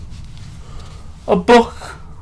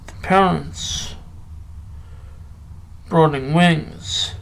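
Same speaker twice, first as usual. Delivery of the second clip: slow, measured poetry reading with long pauses; poem recital; 3 dB quieter, much slower than usual. Acted. Reading a Byron poem to listeners, slow recital. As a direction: let each line breathe like a recital.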